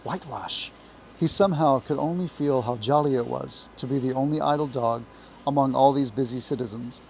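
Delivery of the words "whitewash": soft, hushed nagging; whispering. A narrator's reading. Uneasy conscience pricking the mole, soft whisper. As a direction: drop to a hush, like a prick of conscience. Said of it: "pricking him and whispering".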